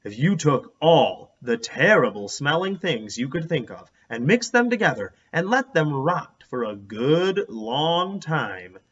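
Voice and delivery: plain reading voice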